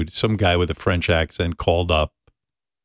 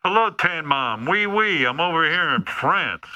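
much higher, hammed-up French accent; mock French lover; 9 semitones higher than usual. Host mocks the French suitor wooing Tan Mom, high hammed-up French accent. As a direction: play a cheesy French playboy in a silly high voice. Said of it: "Does he speak with a French accent?".

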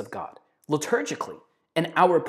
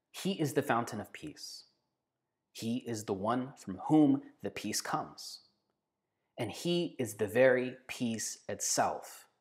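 reverent quoted reading; 3 semitones lower, 5 dB quieter, slower than usual. Acted. Quoting priest's words reverently.

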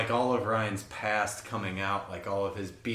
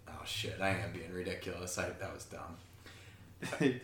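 much softer, lower, slowed admission; sheepish retraction; 6 semitones lower, 9 dB quieter, slower than usual. Retold or imitated. Carrie giving in to Doug's innocent tone, quiet and sheepish. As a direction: back down meekly, embarrassed. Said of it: "the other person just goes like".

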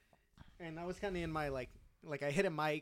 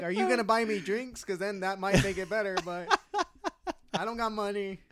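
laughing; 7 semitones higher, 11 dB louder than usual. Retold or imitated.

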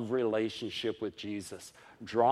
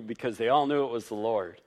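normal talking voice

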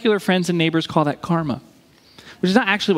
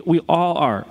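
ordinary delivery

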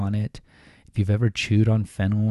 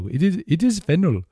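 lightly imitated Indian accent, pitched higher; a playful, higher-pitched Indian accent; 9 semitones higher, 4 dB louder than usual. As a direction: quote the waiter in a hint of an Indian accent. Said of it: "please let me do my Indian accent".

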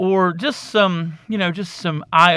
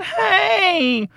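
high, whiny spoiled patient; mock a kid boasting about parents; squeaky, high show-off; entitled brat voice; 12 semitones higher than usual. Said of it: "here you are going".

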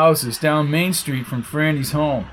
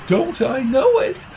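squeaky voice; 10 semitones higher than usual. A narrator's reading. Flo's squeaky remark.

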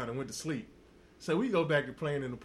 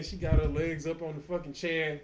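ordinary talk, nothing marked

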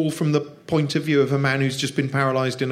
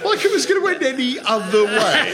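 high-pitched, laughing scoff; 11 semitones higher, 4 dB louder than usual. Real, not acted. Laughing at a reader's spoiler complaint, high scoff.

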